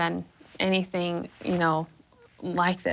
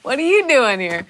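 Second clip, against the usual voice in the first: raised, loud mock-innocent question; feigned surprise; 6 semitones higher, 7 dB louder than usual. Caught teen greets family friend, feigned surprise. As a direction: play innocent, loud and higher.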